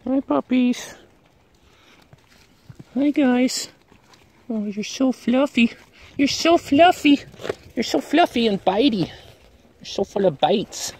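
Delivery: high, squeaky pet voice. Real, not acted. Vlogger gushing over fluffy biting puppies, high baby-talk voice.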